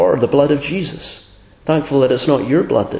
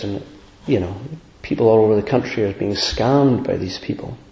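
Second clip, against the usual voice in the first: usual speaking voice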